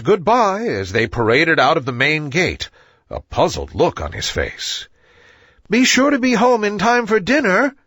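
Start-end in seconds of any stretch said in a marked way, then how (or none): 5.7-7.7 zookeeper calling out. A narrator's reading. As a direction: call out kindly across the yard. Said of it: "he yelled after them".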